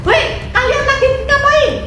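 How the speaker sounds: high, loud startled yell; shout. Retold or imitated. Tongco catching the two embracing, high loud shout. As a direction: catch them in the act with a yell. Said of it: "Dia berteriak".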